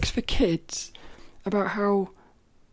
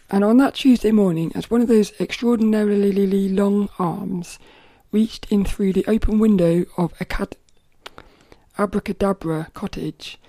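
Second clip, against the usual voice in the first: storybook reading; 7 dB louder than usual. Acted. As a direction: read it out like to a room of kids. Rereads storybook aloud.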